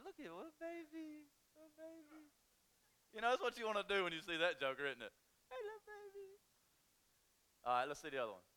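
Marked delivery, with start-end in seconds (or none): 0.0-2.3 high, soft cooing voice; baby talk. Pretend. Pastor coos at a baby picture, high soft baby talk. 5.5-6.4 high, soft cooing; baby talk. Pretend. Pastor coos to the baby on screen, high soft baby talk.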